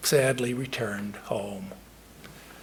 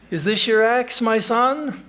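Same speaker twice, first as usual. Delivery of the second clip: high, louder kindly goddess voice; the Lady of the Lake to the woodman, high and gracious; 8 semitones higher, 5 dB louder than usual. Acted. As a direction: rise up out of the lake and offer it grandly.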